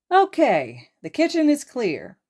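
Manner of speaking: high, loud call across the house; yelling. Acted. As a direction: yell it out cheerfully. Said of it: "Hizashi yells out".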